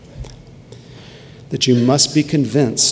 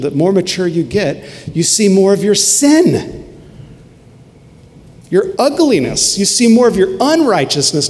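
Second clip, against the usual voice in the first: higher, louder preaching push; fervent preaching; 4 semitones higher, 3 dB louder than usual. Acted. Pastor urging his church about maturity, fervent preaching. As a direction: drive it home from the pulpit.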